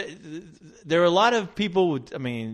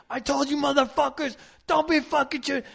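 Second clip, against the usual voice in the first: mock angry yell; 11 semitones higher than usual.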